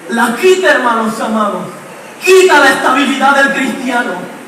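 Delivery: ordinary talk, nothing marked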